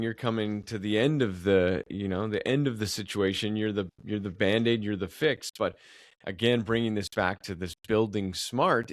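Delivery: ordinary talk, nothing marked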